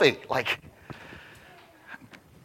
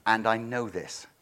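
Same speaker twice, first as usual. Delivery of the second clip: low, quiet, deadpan aside as God; quiet sarcastic God voice; God's dry aside; 9 semitones lower, 12 dB quieter than usual. Acted. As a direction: mutter it as God, unimpressed. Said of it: "imagine God going".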